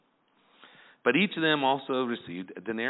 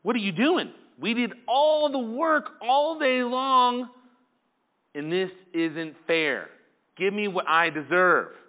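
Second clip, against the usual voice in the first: higher, slower, aggrieved workers' protest; grumbling complaint; 8 semitones higher, 4 dB louder, slower than usual. Retold or imitated. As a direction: whine at the master that it isn't fair. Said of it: "They start grumbling and complaining".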